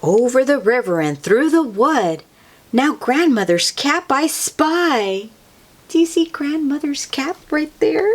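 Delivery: plain reading voice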